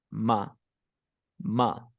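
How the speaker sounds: "ma" said short and relaxed for "my"; reduced my. Shown as a demonstration. Teacher demonstrates natives saying "my" as "ma". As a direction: drop the glide, just "ma". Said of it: "En lugar de decir my".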